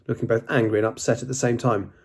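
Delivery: ordinary delivery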